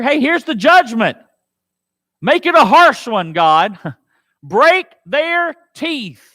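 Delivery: high, loud, slow ending; loud plea. Acted. Preacher as David demanding harsh judgment, loud. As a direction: build to a cry for judgment.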